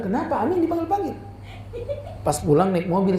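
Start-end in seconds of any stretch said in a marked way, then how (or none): 0.0-1.2 puzzled question. Retold or imitated. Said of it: "Saya bilang".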